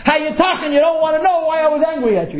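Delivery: mock outrage, high-pitched and worked up; storyteller's high, heated imitation of a rage; retold rage. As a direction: play an indignant man flying into a rage. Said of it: "goes into a rage".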